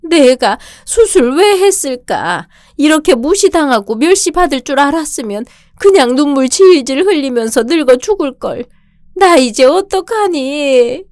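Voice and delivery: weep over your own regret; tearful lament. Acted. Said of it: "울지 마세요".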